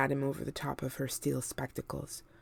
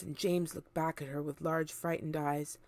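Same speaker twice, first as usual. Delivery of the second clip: ordinary delivery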